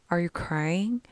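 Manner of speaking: softly asking, quietly concerned; gentle soft question. Retold or imitated. Teacher softly asks the tearful boy if he is crying. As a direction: ease the question out softly, with care.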